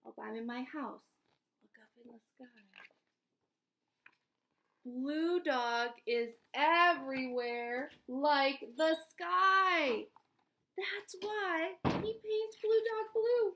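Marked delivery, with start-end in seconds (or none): 1.6-2.5 whispered Look up in the sky. Acted. Whispering after "I'm in my house. 4.8-13.5 slow, dramatic storybook reading; storytelling. Acted. Dramatic storybook explanation to kids.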